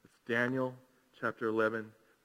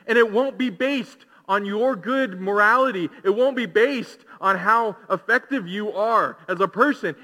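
loud, raised, impassioned sermon delivery; high-energy pulpit proclamation; full-force preaching; 8 semitones higher, 12 dB louder than usual. Real, not acted. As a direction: preach it out, driving each promise home.

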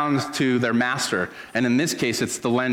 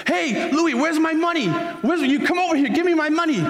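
very high-pitched Brooklyn mobster demanding payment; Brooklyn accent; 3 dB louder than usual. Acted. Young lender calling after his debtor, high-pitched Brooklyn accent. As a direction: holler it in a put-on Brooklyn accent. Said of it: "My, my Brooklyn accent is way better".

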